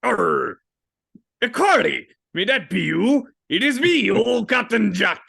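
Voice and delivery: hearty, high and loud pirate greeting; old pirate captain. Acted. Old captain greeting a lost crewmate, as a loud pirate.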